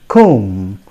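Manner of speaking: "comb" as "kome", silent b; low "comb" held on m; careful "comb" with long o, no b; correct pronunciation. Shown as a demonstration. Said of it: "The correct pronunciation is".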